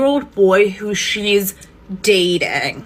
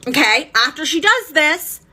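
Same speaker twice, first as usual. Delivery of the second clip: usual speaking voice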